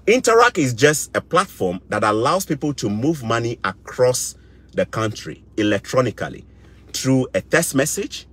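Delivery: ordinary delivery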